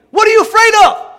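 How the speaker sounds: very high, loud demand; exasperated preacher's shout; shouted challenge. Real, not acted. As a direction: hurl the question at the crowd. Challenges congregation at peak.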